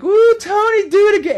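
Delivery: put-on very high, loud squealing girls; loud high-pitched mock party girl squeal; mock giggly party girls. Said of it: "all the girls are just like".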